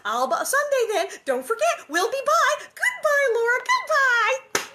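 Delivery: very high, loud, laughing chirp; chiming Nellie. Acted. Nellie chimes in to Laura, very high and loud. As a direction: chirp the goodbye sweetly. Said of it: "Nellie chimed in".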